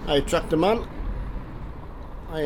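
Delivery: usual speaking voice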